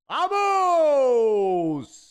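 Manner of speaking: celebration cry. Real, not acted.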